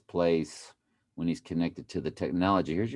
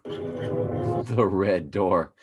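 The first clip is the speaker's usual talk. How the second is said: very slow, drawn-out words; ominous slow drawl; much slower than usual. Pretend. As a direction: stretch it out like a horror trailer.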